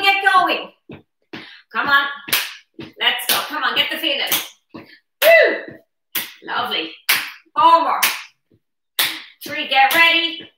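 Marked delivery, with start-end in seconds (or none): 4.7-5.7 high cheering woo; excited whoop. Real, not acted. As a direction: whoop to fire up the class. Cheering on a workout class.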